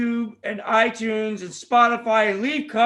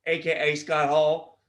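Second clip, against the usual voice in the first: ordinary talk, nothing marked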